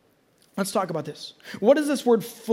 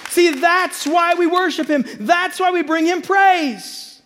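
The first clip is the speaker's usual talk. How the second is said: loud, high preaching building to a shout; preaching at full force; 6 semitones higher, 3 dB louder than usual. Acted. Pastor rallying the congregation, rising full-force preaching. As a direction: lift the room, preaching hard.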